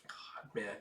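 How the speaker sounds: breathless whisper of real grief; heartbroken whisper. Real, not acted. Viewer reacting to a sad scene, choked whisper. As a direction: whisper it, too moved to speak. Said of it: "that just broke my damn heart".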